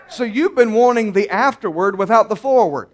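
ordinary talk

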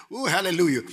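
ordinary talk, nothing marked

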